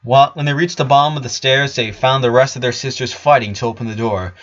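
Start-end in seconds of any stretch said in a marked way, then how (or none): none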